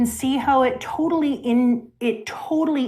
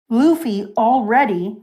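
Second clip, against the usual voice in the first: usual speaking voice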